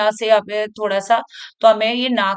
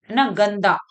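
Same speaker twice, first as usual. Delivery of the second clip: usual speaking voice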